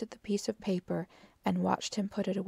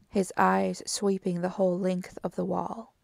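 ordinary delivery, nothing marked

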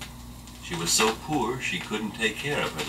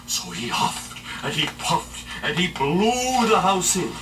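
dramatic narration; 10 semitones higher, 3 dB louder than usual. A narrator's reading.

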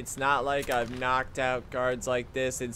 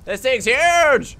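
loud, high, drawn-out shout of amazement; startled exclamation; 10 semitones higher, 8 dB louder, much slower than usual. Real, not acted. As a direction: blurt it out loud, stretching the last word. Startled by giant snake in game.

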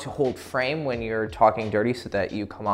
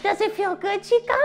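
very high, silly mock voice; high squeaky voice. Shown as a demonstration. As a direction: squeak it out comically. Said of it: "you need to be sexy with it".

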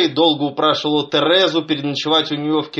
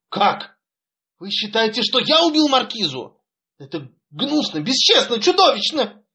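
indignant outcry; 8 semitones higher than usual. A narrator's reading. Son cries out at father's accusation, outraged. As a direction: pitch climbing much higher to a cry. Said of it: "выпрямился и воскликнул".

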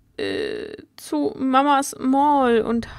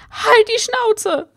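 furious, high, loud and slow yell; angry shout; 10 semitones higher, 5 dB louder, much slower than usual. Acted. Reagan screams at Sophie to shut up. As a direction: snap back hard at being bossed around.

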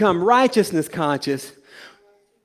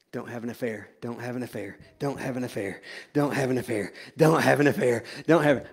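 a soft, worried chant; hushed nervous mantra; 8 semitones lower, 8 dB quieter, faster than usual. Pretend. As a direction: mutter it quietly over and over. Fearful mantra about affair.

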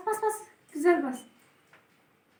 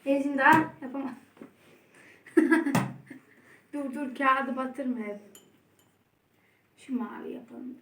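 ordinary delivery

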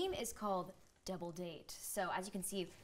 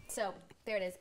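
ordinary talk

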